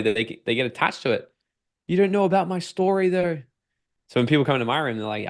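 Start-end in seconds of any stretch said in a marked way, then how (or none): 1.9-3.4 high, defensive whine of someone clinging to their story; imitating a defensive client. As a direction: play the client who won't budge.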